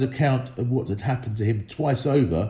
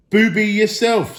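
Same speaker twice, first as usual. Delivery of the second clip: loud, higher insult; insulting troll; 9 semitones higher, 6 dB louder than usual. A narrator's reading. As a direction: sneer the insult. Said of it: "said Tom".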